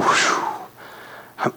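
soft exhaled whew of relief; relieved whew. Retold or imitated. Pastor done with his week, a soft exhaled whew. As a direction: blow out a tired whew. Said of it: "man, I'll just go".

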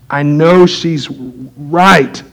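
very slow, drawn-out concession; low, halting and grudging; reluctant admission. Real, not acted. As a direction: admit it grudgingly, stretching the words out. Grudgingly repeats that wife is right.

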